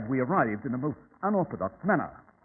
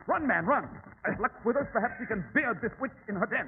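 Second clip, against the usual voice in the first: rally him into a run; urgent; 7 semitones higher than usual. Acted. Holmes urges Watson to chase the witch, urgent call.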